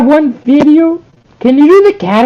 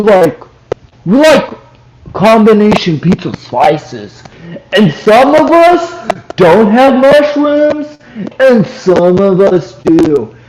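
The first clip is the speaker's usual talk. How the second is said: imitating catechist; 8 semitones lower than usual. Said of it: "you pretend to be a Novus Ordo catechized".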